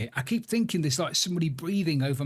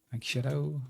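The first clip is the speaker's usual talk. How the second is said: ordinary delivery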